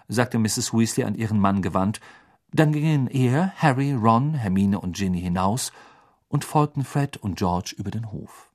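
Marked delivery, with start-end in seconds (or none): none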